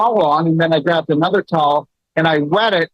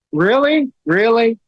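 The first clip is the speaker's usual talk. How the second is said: much higher, scoffing disbelief; incredulous really; 7 semitones higher, slower than usual. Real, not acted. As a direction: throw the word back in disbelief. Scoffing at husband's antibiotic insistence.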